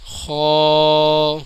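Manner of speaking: خا heavy, rounded, held long; full and thick. Shown as a demonstration. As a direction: thicken the vowel of خا and stretch it. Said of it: "یہ پر یعنی موٹا پڑھا جاتا".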